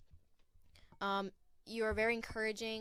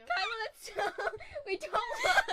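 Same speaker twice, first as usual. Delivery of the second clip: high, loud, slow laugh-crying; half laughing, half sobbing at a friend's tears; laughing through tears; 14 semitones higher, 5 dB louder than usual. Real, not acted. Friend cries during farewell speech.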